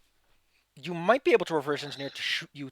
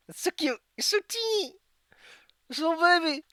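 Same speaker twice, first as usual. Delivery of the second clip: cooing; 4 dB louder than usual. Real, not acted. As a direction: coo over a tiny kitten. Cooing over tiny cat.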